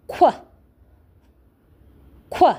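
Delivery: "qu" said as a crisp kw sound; phonics qu sound. Shown as a demonstration. Teacher modelling "qu" for the class. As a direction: model the "qu" blend slowly for children. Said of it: "the sound that they make together is qu".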